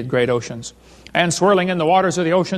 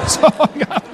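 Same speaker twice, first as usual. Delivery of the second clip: can't get the words out for laughing; real laughter cracking the voice up high; 4 semitones higher, 3 dB quieter than usual. Real, not acted. Laughing at his own story.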